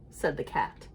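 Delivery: usual speaking voice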